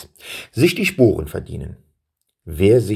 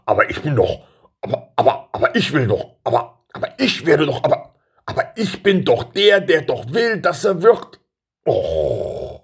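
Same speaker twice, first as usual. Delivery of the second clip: stammering, climbing higher into a whine, then deflating; flustered protest; 6 semitones higher than usual. A narrator's reading. Son protesting to the king that he should be chosen, flustered. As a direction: keep restarting, ever higher, then drop on a groan.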